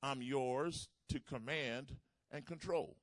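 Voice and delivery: slow, loud, surrendering prayer; a preacher voicing a vow of surrender; fervent prayer. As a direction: pray it out slowly with full weight. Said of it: "That means".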